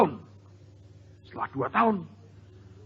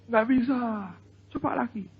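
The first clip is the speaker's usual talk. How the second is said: put-on child's voice; 6 semitones higher than usual. Child voiced failing to lift.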